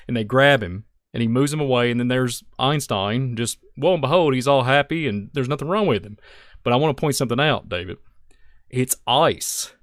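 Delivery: normal talking voice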